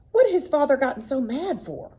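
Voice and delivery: plain reading voice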